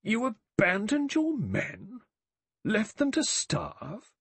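slow, stern reproach, pitched high. A narrator's reading. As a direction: demand it with cold disapproval. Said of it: "said sternly".

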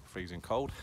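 ordinary talk, nothing marked

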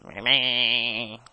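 bleating sheep voice; sheep impression. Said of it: "that's my sheep impression".

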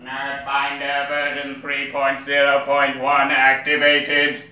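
slow, robotic device voice; robot announcer. Acted. A gadget activating its nerd finder, slow and robotic. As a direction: announce it like a machine booting up.

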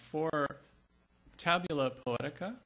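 ordinary talk

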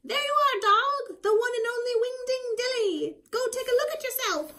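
high, loud proud witch. Acted. Zildy proudly showing the dog his new body, high. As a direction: present your creation proudly. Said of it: "Zildy speaking to dog".

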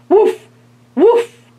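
high, loud barking dog. A narrator's reading. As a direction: bark it out like a dog. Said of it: "barked the dog".

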